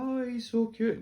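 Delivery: put-on high, loud cooing old lady voice; old woman cooing. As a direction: coo like a sweet old woman over a puppy. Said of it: "She's like".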